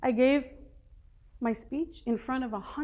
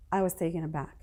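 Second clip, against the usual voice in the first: usual speaking voice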